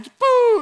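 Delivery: high, loud cartoon whoosh; a sudden booming genie sound effect; genie blast sound. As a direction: blast him away like a genie. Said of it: "like the genie did in".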